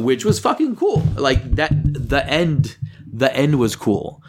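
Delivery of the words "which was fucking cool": sudden high burst of delight; light up over the ending; excited. Real, not acted. Telling a co-host about the ending, excited.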